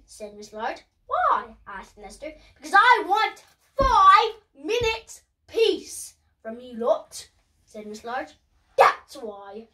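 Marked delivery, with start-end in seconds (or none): 1.1-1.6 put-on high child voice. Lester asks Mum why. 2.6-9.7 fed-up Mum. Acted. Mum answers the children sharply.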